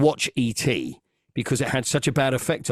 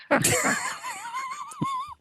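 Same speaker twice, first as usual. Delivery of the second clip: very high shrieking burst of laughter; 5 dB quieter than usual. Real, not acted. At his own Flipper theory, a high shrieking laugh. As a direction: let out a squealing laugh.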